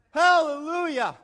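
high, drawn-out holler; hammed-up, very loud cry; desperate shout. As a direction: yell the command at the last second. Rider remembers the stop command.

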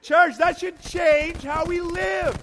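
high, loud, slow exhortation; impassioned preacher's shout. Real, not acted. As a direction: cry it out to the whole church. Preacher exhorting the congregation at full force.

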